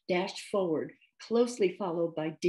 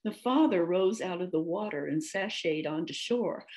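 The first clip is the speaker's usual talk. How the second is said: plain reading voice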